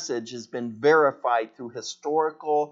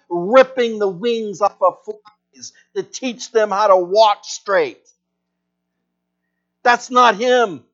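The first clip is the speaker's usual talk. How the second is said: impassioned sermon, louder and higher; preaching at full force; 4 semitones higher, 5 dB louder than usual. Acted. Preacher pleading with the grieving congregation, impassioned. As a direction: pour conviction into every line.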